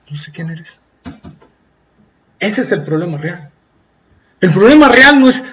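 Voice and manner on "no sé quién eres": much softer, final; cold quiet denial. Acted. The Lord rejecting the believer in a hush. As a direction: drop to a near hush of rejection.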